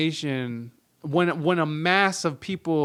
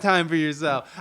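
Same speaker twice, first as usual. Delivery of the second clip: ordinary talk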